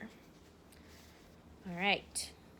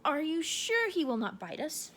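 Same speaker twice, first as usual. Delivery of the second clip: timid voice; 7 semitones higher than usual. Acted. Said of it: "asked in a timid voice".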